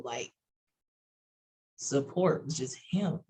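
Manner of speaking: normal talking voice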